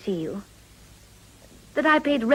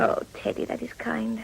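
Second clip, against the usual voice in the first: breathy near-whisper "Oh, Teddy", then softer; touched, hushed; 7 dB quieter than usual. Acted. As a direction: hush it out, moved by his kindness. Wife touched by husband's kindness.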